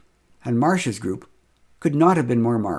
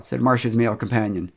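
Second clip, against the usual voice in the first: plain reading voice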